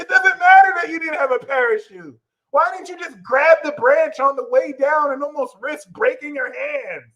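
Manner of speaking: high, loud, outraged mock yell; mock angry Sag. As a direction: play the furious fire sign ranting at their partner. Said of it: "Sag ranting back".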